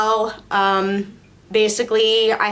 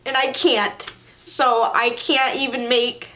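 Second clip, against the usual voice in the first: usual speaking voice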